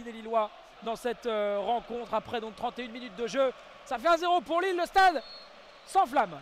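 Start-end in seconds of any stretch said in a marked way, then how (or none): 3.8-6.4 high, loud goal recap; excited sports commentary. Real, not acted. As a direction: ride the crowd's roar. Recap of goal, crowd erupts.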